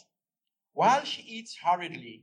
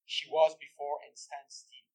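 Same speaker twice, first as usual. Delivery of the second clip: normal talking voice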